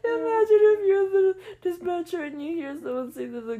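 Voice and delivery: high laughing talk. Real, not acted. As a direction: laugh through the words. Still laughing.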